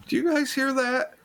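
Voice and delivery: sudden high, startled; startled question. Retold or imitated. Quoted friend in story.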